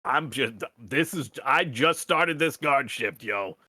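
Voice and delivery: nervous new guard backing off; flustered guard. Acted. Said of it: "he's just kind of backing up, like".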